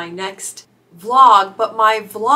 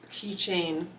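ordinary talk, nothing marked

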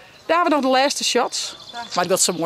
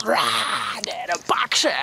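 pirate whisper; 2 semitones lower, 4 dB quieter, much slower than usual. Acted. Pirate attack scene while filming.

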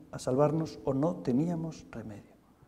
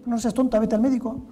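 put-on high, louder, bossy old grandmother; scolding grandmother; 8 semitones higher, 3 dB louder than usual. Grandmother scolding her granddaughter to see a doctor, high and bossy. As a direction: shoo her off to the doctor, pitched way up.

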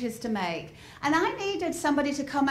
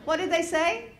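ordinary delivery

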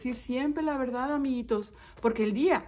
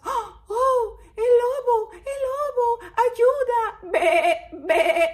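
high, loud panicked cries; frightened sheep; 12 semitones higher, 7 dB louder, much slower than usual. Acted. Sheep seeing the real wolf, frightened. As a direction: bleat in acted terror. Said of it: "Estaban todas asustadas".

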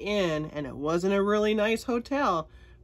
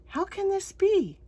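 surprised; 13 semitones higher than usual. Acted. Mary's surprised reply in story.

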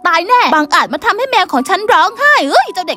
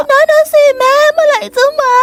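put-on scared child; 7 semitones higher than usual. Child waiting outside in the dark for mother, scared. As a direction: call out nervously into the dark.

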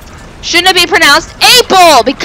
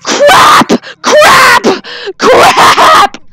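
high loud shouted "Crap!"; panicked shout; 10 semitones higher, 4 dB louder, much slower than usual. Retold or imitated. Retelling the blast disaster, loud high panicked shouts. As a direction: yell it as the blast goes off. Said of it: "And I was like".

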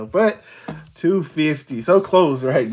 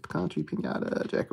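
whispered; 10 semitones lower, 6 dB quieter, faster than usual. Real, not acted. Same symbols repeated under breath.

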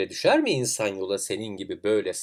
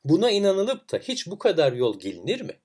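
high-pitched incredulous question; inanmaz alay; 8 semitones higher, 4 dB louder than usual. A narrator's reading. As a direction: question it as if it were madness. Alaycı kalabalık inanmıyor. (hayretle, inanmayarak)

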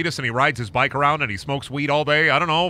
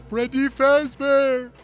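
high, slow, imitating a gravel-voiced star; mock star voice saying the name; Danny DeVito; 10 semitones higher, slower than usual. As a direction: do a light celebrity impression of the name. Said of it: "that Danny DeVito is playing Freddy Fazbear".

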